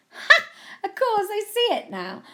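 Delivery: loud ha, then high-pitched delight; high, delighted recognition; amused realisation. A narrator's reading. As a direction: burst out as it clicks. Guess finally revealed.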